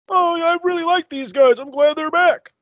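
high, silly voice mimicking a clueless fan; mocking fan voice. Mocking fans of the other show.

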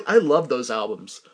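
ordinary talk

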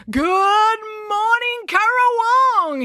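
very high, hugely drawn-out greeting; rally the crowd with stretched-out words; bellowing. A narrator's reading. Brad over the microphone to Currawong, bellowing. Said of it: "he bellowed over the microphone".

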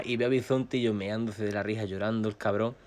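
ordinary delivery

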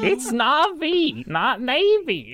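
high, slow whiny mock correction; mocking name correction. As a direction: stretch the names out petulantly.

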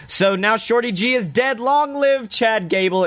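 crown the new man, stretched out and lifted; triumphant proclamation. Real, not acted. Fan hailing Chad Gable's new start, triumphant and high.

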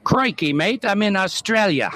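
a hint of a mock Australian accent; Australian greeting, lightly put on. Caller greeting the host from Sydney, in a light mock Australian accent. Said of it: "Do they say crikey?".

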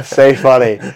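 ordinary delivery

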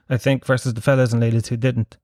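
normal talking voice

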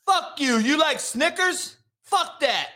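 hammed-up touch of anger; mock outrage. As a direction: pick a petty fight over nothing. Acts out petty attack over candy.

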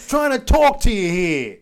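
playful loud mock complaint; mock annoyed tough guy. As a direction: ham up an irritated local. Riffing on tough-guy bit.

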